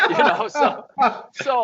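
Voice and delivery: high, loud laughing words. Real, not acted. Golfer just delivered his story's punchline, laughing. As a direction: let real laughter break into the words.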